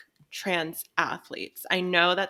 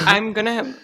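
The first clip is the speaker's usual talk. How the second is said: British accent kicking in, louder; 3 semitones higher, 9 dB louder than usual. Real, not acted. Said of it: "I just started talking in a British accent".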